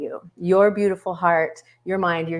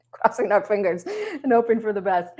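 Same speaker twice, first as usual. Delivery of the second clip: laughing; 5 semitones higher than usual. Real, not acted. Laughing about tech trouble.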